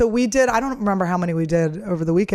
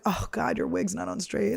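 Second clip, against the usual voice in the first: muttered under the breath, much softer; hushed aside; 9 dB quieter than usual. Retold or imitated. Comic telling how she privately reacts to the worker's wig, hushed. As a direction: say it quietly to yourself, pitying. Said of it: "You're just like".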